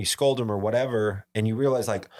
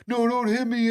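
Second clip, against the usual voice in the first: high-pitched imitated panicked wail; crying plea; 13 semitones higher than usual. Kid hiding from dad's paddle, imitated crying plea. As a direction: beg in a squealing mock cry.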